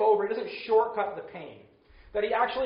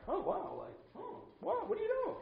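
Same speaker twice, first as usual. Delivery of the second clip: high, breathy-free airy mock surprise, much higher and softer; mock indifferent king; 11 dB quieter than usual. Uncaring king hearing of deaths, airy mock wonder. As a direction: play the careless king who shrugs off deaths.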